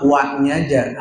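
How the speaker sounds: usual speaking voice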